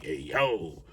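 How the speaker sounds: low, soft "hey, yo" under the breath; hushed retold reaction. Narrator reacts to three cop cars rolling up, hushed. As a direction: drop it low and quiet, reacting to trouble.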